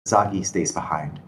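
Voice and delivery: ordinary delivery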